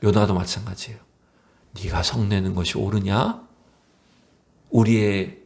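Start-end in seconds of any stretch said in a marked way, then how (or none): 1.8-3.4 low, calm, tender question; gentle questioning. Acted. God asking Jonah about his anger, gentle and calm. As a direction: ask quietly, without blame. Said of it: "부드럽게 질문하시는 겁니다".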